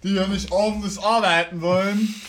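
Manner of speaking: ranting like a grumpy bigot; mock bigot rant.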